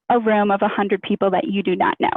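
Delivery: ordinary delivery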